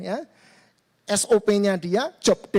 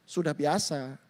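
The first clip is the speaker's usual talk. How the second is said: ordinary delivery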